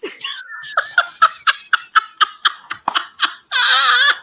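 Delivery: big shrieking laugh, real; loud laughing cackle. Real, not acted. Woman laughing at her own breakup story, loud cackle. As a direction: cackle high and loud at yourself.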